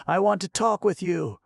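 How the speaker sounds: put-on a brisk elderly aunt; older woman voice. Aunt's voice read by narrator.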